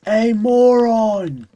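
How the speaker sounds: high, loud indignant protest, drawn out; Portal two outburst. Acted. Streamer quoting a game character protesting, high indignant shout. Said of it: "the fucking conversation in Portal two".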